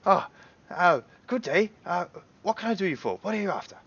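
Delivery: high, quick shopkeeper patter; halfling shopkeeper. Acted. Halfling shopkeeper asks the party what they want: high, quick. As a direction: rattle off the offer, eager to sell. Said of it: "He goes".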